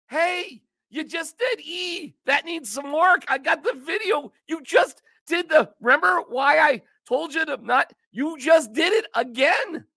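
high-pitched scolding boss, mildly hammed up; a boss calling out the slip, half-joking; mock angry boss. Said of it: "they say".

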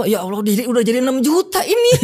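a mock plea broken by laughter; laughing prayer. Recalling the debt growing, laughing as he prays. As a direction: laugh through the complaint.

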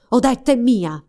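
loud snarling claim; growled threat. Acted. Sorcerer snatching Odette from the prince, loud snarl. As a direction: snarl it as you seize her. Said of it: "ringhiò".

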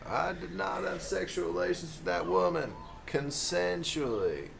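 slow, soft drawled denial; Bill Clinton impression. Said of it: "Bill Clinton".

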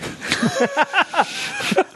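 high, breathy giggling fit; real laughter. Real, not acted. Teller breaking up over the clock story, laughing. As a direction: crack up at your own story.

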